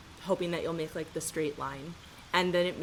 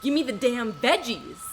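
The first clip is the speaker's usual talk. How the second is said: higher, louder mock movie outburst; iconic movie line; 4 semitones higher, 7 dB louder than usual. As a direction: belt it out like a famous film line. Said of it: "That iconic line from Fast Five".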